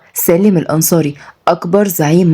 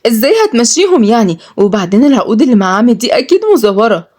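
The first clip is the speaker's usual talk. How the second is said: put-on high-pitched indignant young woman; angry; 5 semitones higher than usual. Malak challenges the buyer of her house, angry. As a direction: challenge him crossly, pitched up. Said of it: "واتعصبت ملك".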